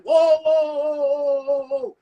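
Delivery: loud hammed-up whoa, whoa, stopping someone; shouted whoa. Man showing his wife how to cut off her dad, loud whoa. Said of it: "She supposed to be like".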